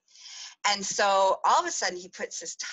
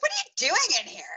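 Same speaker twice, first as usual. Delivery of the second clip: startled; 12 semitones higher, 4 dB louder, faster than usual. Retold or imitated. Startled by boy in bathroom.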